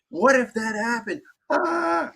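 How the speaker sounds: high, alarmed gasp at the end; mock panic. Anxious mind reacting to a bad thought, mock panic. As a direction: act out a sudden spike of fear.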